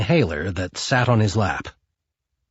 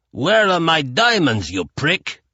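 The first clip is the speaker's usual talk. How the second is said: angry; 5 semitones higher than usual. A narrator's reading. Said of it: "Chukov came back angrily".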